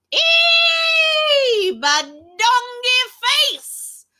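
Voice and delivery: high, loud squeal into drawn-out nonsense; squeal, then stretch the nonsense words; silly sounds. Acted. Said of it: "make silly sounds like".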